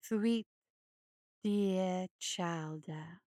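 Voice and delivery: low, slow, creepy hare voice; low creeping monster croon; hulking hare creature. Acted. Luther the hare speaks.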